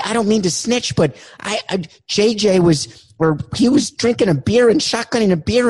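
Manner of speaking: high, fast jittery voice; high-pitched caller. Acted. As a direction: squeak it out in a rush.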